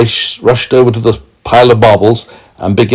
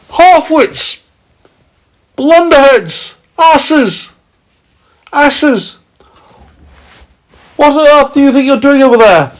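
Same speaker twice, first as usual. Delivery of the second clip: high, loud jeering yell. A narrator's reading. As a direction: yell insults across the sky. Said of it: "yelling at the cloud men as loud".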